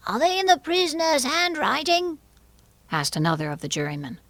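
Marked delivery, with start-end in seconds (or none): 0.1-2.2 very high-pitched questioning character; second juryman's voice. A narrator's reading. Another juryman asks about the handwriting, high put-on voice.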